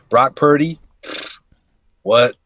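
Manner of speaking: ordinary delivery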